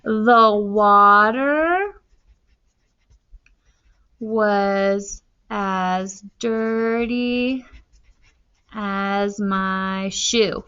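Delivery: slow, drawn out dictation. Shown as a demonstration. Teacher writing a simile about the water, slow dictation. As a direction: pace it to the pen. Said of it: "So that tells you".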